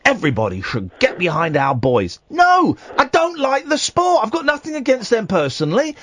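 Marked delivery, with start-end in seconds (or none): 2.3-4.2 emphatic rant. Real, not acted. Ranting about football.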